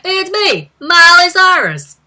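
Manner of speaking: high, loud bubbly intro. Retold or imitated. Vlogger imitating the sketch [name] introducing herself, high and loud. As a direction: announce yourself like an excited teen star.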